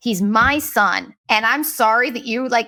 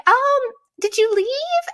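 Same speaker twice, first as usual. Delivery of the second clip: high, slow, sugary mocking voice; mock sweet question; slower than usual. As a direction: imitate her fake-innocent asking. Mimics the woman's message.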